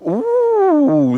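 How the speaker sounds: high, delighted ooh; impressed ooh. Real, not acted. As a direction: coo at the new parts. Opens the box.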